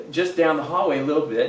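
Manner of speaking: ordinary talk, nothing marked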